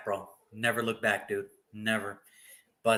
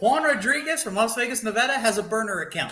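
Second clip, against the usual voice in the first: lightly voiced mock announcer, a touch higher; 8 semitones higher than usual. Host announcing Juan's burner to viewers, mock announcer. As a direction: announce it like a ringmaster, teasingly.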